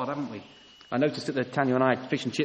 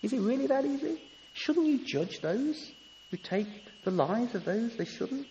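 high, pleading prophet crying out to God; a touch of the anguished prophet, pitched up; voicing Habakkuk's plea; 9 semitones higher, 4 dB quieter than usual. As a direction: plead with the Lord, lightly voiced. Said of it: "That's what Habakkuk's saying here".

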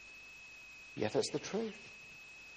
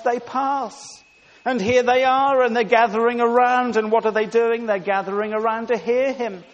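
loud, raised, insistent sermon delivery; impassioned preaching; 6 semitones higher, 11 dB louder than usual. Acted. Preacher marvelling to the church that sinners pass the test, impassioned. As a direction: drive each phrase at the congregation.

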